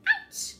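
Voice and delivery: high squeaky yelp; squeaky little ouch; mock ouch. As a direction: yelp like a trodden mouse. Mouse hurt, yelps.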